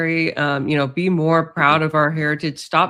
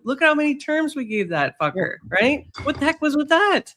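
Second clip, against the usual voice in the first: higher, put-on Canadian accent on the rant; 8 semitones higher than usual. Griping about Trudeau's terms in a put-on Canadian accent. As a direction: let the Canadian accent slip out mid-rant. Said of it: "my Canadian accent comes out a bit".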